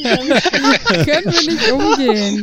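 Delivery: amused laughing. Real, not acted. Laughing about the new name.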